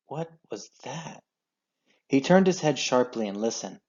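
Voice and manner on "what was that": sudden soft alarm, breaking off; startled hush. A narrator's reading. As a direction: catch a noise mid-sentence. Sudden noise interrupts.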